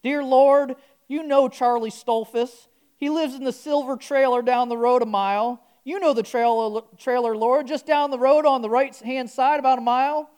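mock Southern accent prayer; southern twang. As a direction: pray like a Southern country man. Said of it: "I'm gonna do this with a southern twang".

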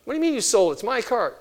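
put-on outraged teen. Teenage Dan shouting at his dad about the sold car. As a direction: demand it back, furious. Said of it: "Kid went crazy".

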